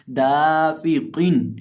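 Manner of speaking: दाफिकिन with दा drawn out; lengthened Da. Shown as a demonstration. Teacher shows pupils the long दा, slow. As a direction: stretch the दा of दाफिकिन. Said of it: "दाल को खींचेंगे".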